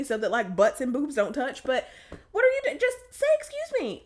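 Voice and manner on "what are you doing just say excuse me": very high, exasperated squeal. Acted. To people who won't speak up, exasperated. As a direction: plead in a pitched-up voice. Exasperated rant at silent squeezers.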